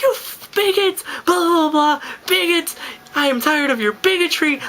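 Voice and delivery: very high-pitched held-back yell; mock drunken yelling. Bawling drunk yelling at bottle throwers, imitated high yell. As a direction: wail it out in a hammed-up high voice. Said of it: "I literally just start yelling".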